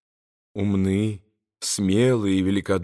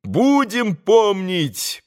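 high, drawn-out fervent cry; ringing, stretched-out pledge; boys crying out; 9 semitones higher, much slower than usual. A narrator's reading. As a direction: call it out slowly as eager boys. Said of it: "прокричали опять мальчики".

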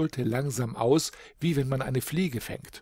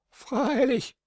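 much higher, bleating goat voice; 13 semitones higher than usual. A narrator's reading. Goat agreeing with the boy, high bleat. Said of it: "meckerte die gute Schimmermilch".

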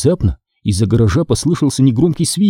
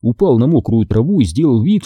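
ordinary delivery

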